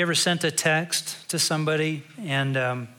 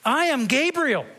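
booming, higher proclamation of the name; commanding angel; 10 semitones higher, 4 dB louder than usual. Acted. Angel to doubting priest, booming and proud. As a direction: announce yourself as the mighty Gabriel. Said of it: "I think he said it in that tone".

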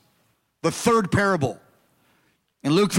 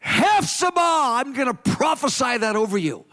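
retold prophetic proclamation; 4 semitones higher than usual. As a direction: proclaim it over a crowd, stretching the name. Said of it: "every single time I would say".

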